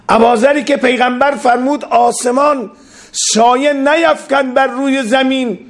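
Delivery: high, ringing preacher's cry; full-force preaching. Acted. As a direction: lift it up like a pulpit climax. Preacher raises voice quoting prophet.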